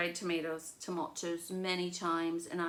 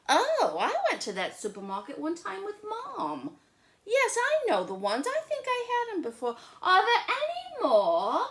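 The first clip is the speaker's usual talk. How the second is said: put-on much higher, louder excited little girl; Lola eager; 13 semitones higher, 8 dB louder than usual. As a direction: bubble over with excitement. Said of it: "said Lola".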